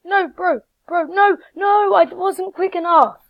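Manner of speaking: loud, high, exasperated no no no; frustrated yelling. Real, not acted. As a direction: yell at the screen after losing. Loses the fight in game.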